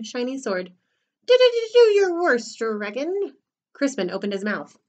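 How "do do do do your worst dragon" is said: high, loud, stammering dare; nervous knight bluffing bravery; squeaky knight. Acted. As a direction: stutter the challenge in a squeak. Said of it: "squeaked a thin, skinny knight".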